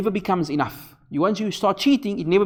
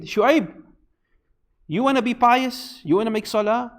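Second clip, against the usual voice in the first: sarcastic mockery; 6 semitones higher than usual. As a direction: sneer at the prophet's piety, lightly acted. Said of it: "They were very sarcastic".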